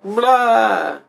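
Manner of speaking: high blurted mock-Russian noise; mock Russian outburst; bad Russian accent. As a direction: blurt a fake Russian exclamation. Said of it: "be like".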